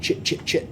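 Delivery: Chit said with ch, unvoiced; Chit spelt C I H T. Shown as a demonstration. Censoring himself for kid viewers, unvoiced Chit. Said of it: "C I H T, not S H".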